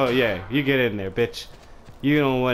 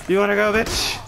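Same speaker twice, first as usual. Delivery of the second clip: high challenge, building to a loud yell; taunt into battle cry; 10 semitones higher, 5 dB louder than usual. Pretend. Gamer attacking an enemy, taunting war cry.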